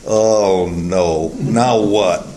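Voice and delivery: low, sinking mock dismay; dismayed disciples. Disciples reacting to an impossible command, low and dismayed. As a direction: groan it low, as if hopes just sank. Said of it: "just picture the disciples going".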